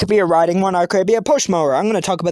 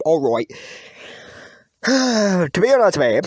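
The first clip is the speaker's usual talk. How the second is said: normal talking voice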